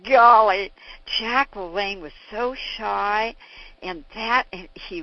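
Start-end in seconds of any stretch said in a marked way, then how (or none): none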